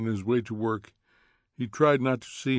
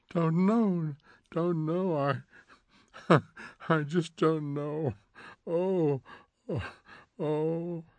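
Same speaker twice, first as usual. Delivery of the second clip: sobbing; 6 semitones higher, slower than usual. Acted. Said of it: "sobbed Missus Phelps".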